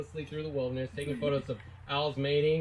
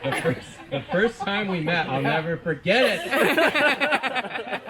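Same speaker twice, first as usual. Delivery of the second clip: laughing through words; 6 semitones higher, 7 dB louder, slower than usual. Real, not acted. Joke setup laughing.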